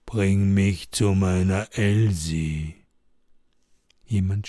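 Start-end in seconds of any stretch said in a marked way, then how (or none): 0.1-2.7 low, slow ghostly plea; a dead man's voice from behind. Acted. Ghost asks Lars to find his Elsie: low slow ghostly voice. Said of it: "Plötzlich hört Lars eine Stimme".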